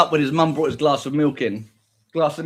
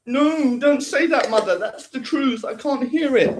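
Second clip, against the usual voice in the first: pleading son; 10 semitones higher than usual. Pretend. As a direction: beg her not to say it. Son protests to mother.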